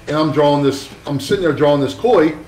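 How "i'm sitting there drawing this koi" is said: usual speaking voice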